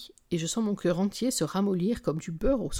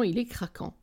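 ordinary delivery

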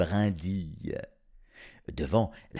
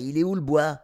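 put-on teasing older brother, pitched up; mocking question; 6 semitones higher, 7 dB louder, faster than usual. A narrator's reading. Older brother teasing the youngest, mocking. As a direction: jeer it at the little brother. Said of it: "questionna son aîné d'un air goguenard".

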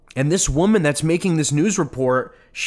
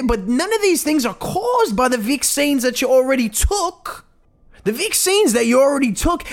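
much higher, sugary mock voice of a cheerful TV nurse; mock motherly woman; 8 semitones higher than usual. Mocking the newsreader's vaccine reassurance, high mock sweet voice. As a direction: coo it down at them like a patronising mother. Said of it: "some motherly woman that's telling you".

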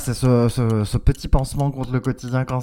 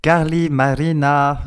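slow Spanish-accented Carly Marina; host keeps a Spanish accent on names; 4 dB louder, slower than usual. Shown as a demonstration. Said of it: "je fais mon accent tout, tout le long".

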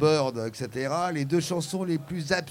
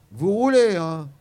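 emphatic cop order; 3 semitones higher, 7 dB louder than usual. Retold or imitated. Cop urging them to drive on.

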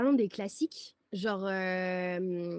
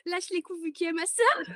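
mock whiny plea; 9 semitones higher than usual. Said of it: "Je lui ai dit".

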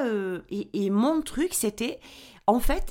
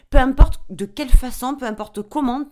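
forceful; 4 semitones higher, 11 dB louder than usual. Retold or imitated. Inner voice pep talk to herself.

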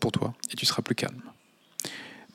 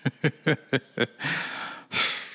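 amused giggle; 5 semitones higher than usual. Real, not acted. Laughing at own impression.

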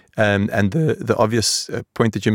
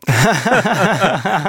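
laughing; 9 semitones higher than usual. Real, not acted. Laughing at own impression.

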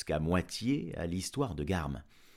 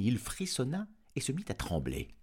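usual speaking voice

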